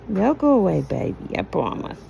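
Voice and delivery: low, hushed reassurance; soothing. Real, not acted. Promising a baby the hiccups will go away, soothing.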